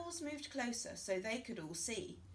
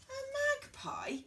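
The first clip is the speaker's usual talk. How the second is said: much higher, alarmed squawk; shocked cluck; 4 dB louder than usual. Acted. Hen hearing her egg holds a magpie, shocked high squawk. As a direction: squawk it in disbelief. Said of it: "clucked Chicken".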